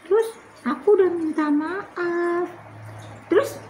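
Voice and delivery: put-on high child voice, pleading at the end; little Tiur. Tiur apologising and begging her sister to skip today's bath. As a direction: wheedle like a sweet small kid.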